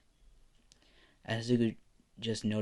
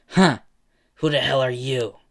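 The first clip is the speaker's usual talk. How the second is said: 1 hostile challenge; 2 semitones higher, 8 dB louder than usual. Acted. Gunman confronting Izuku.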